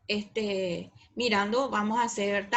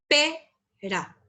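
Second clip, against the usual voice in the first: load the voice onto the PE of "Pera"; stress on the first syllable; 3 semitones higher than usual. Shown as a demonstration. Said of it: "pero se carga la voz".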